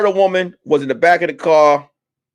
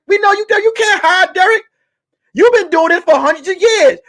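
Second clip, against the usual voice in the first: taunting cop voice; 11 semitones higher than usual. Retold or imitated. Cops taunting reincarnated Derek.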